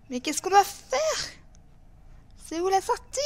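high, alarmed questions; panicked. Real, not acted. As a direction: sound lost and scared. Lost in the dark, panicking. (panique, voix haute)

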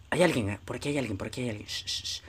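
hurried low murmur fading into shushes; voz baja y sigilosa. Pretend. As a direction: hush it so nobody hears you. Sneaking near an enemy. (murmurando rápido y bajito)

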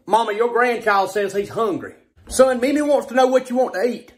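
ordinary talk

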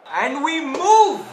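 loud, slow, drawn-out rally cry; rallying shout. Real, not acted. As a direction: fire the team up, shouting. Rallying the squad before kickoff.

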